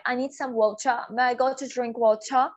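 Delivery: slow British take, "water" as "wau-cha"; British ch-like T, no final R in "water"; British English. Shown as a demonstration. Teacher asks to drink water, British English demo. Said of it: "मैं British English बोल रही हूँ".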